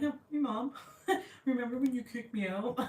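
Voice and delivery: high, playful voice for a cat; jokey high-pitched pet voice; imagined returning cat. Pretend. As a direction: pretend to be the cat, half laughing. Imagines lost cat coming back.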